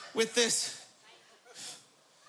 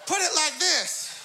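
flight attendant demo; 4 semitones higher, slower than usual. Retold or imitated. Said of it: "and she said".

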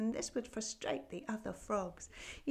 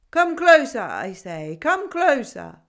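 much louder, high goat bellow; gruff goat shouting; 4 semitones higher, 16 dB louder, slower than usual. A narrator's reading. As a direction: roar it out, impatient old goat. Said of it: "A voice gruff and cobwebby".

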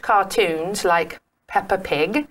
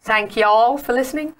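higher-pitched, badly faked American accent; British speaker's clumsy American impression; ghastly American attempt; 6 semitones higher, 3 dB louder than usual. Shown as a demonstration. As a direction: try out an American "y'all", rising. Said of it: "I know it's ghastly".

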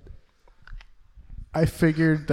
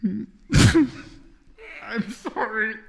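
breaking down in tears; 10 semitones higher, much slower than usual. Acted. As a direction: apologise as you break into crying. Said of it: "Oh, he does get emotional".

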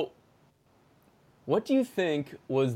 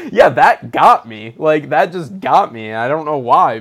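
laughing; 10 dB louder than usual. Real, not acted. Laughing at own jump scare.

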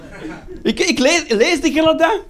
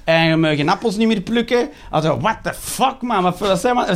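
ordinary delivery

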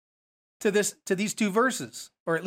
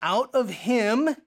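slow, higher "out of him", each word weighted; emphasis on the quote; 5 semitones higher, 4 dB louder, slower than usual. Shown as a demonstration. Teacher putting the emphasis on the next quote, slow stressed phrase. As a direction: punch "out of him" slowly, ending on a rise. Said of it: "putting the emphasis now on the next quote".

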